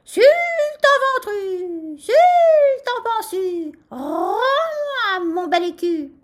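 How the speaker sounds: high, drawn-out rooster crow; crowing at full throat. A narrator's reading. Rooster taunts the sultan for his coin, crowing. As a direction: crow each phrase long and loud, stretching the vowels. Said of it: "et s'égosilla".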